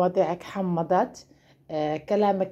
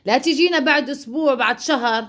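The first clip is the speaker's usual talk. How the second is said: ordinary talk, nothing marked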